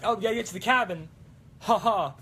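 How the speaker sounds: quick, high, loud hero quote; Ash. Retold or imitated. Imitating Ash heading to the cabin, excited impression. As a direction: rattle it off like an excited movie hero. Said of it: "plot point of just him".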